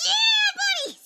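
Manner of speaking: high laughing cheer of yeah buddy; a delighted laughing yell; laughing shout. Retold or imitated. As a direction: whoop it out through laughter. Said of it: "laugh shout".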